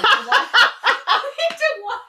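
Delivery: laughing through the words, drawn out high. Real, not acted. Friend joking about scumbags, laughing through it. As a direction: crack up mid-sentence. Laughing at her own joke.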